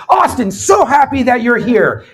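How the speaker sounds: imitated TV host energy; switched-on Trebek. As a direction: power up for the mic. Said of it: "And he goes".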